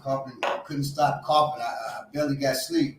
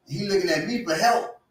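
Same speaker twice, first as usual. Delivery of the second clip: normal talking voice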